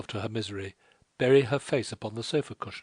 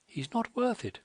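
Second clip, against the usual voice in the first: higher, indignant outcry; cried out; 13 semitones higher than usual. A narrator's reading. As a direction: burst out against the scoundrel. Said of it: "cried Clytie".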